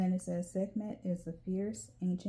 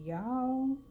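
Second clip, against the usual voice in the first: southern drawl; 7 semitones higher, 5 dB louder than usual. Real, not acted. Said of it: "my southern drawl is coming out".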